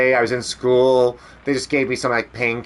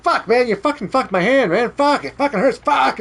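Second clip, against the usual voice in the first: high, raging mock yell of pain; retold angry yell; 12 semitones higher than usual. Boy yelling at the classmate, angry and hurting. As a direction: blame him in a furious yelp. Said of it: "I yelled at him".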